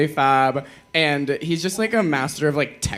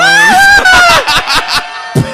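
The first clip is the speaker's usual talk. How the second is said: high loud shriek of laughter; 14 dB louder than usual. Real, not acted. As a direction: scream with laughter.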